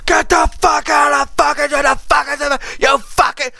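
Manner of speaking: high-pitched screamed imitation of raging parents; mock hysterical screaming; screaming profanity. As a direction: scream it like unhinged parents cursing at kids. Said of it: "they're just screaming profanity at their kids".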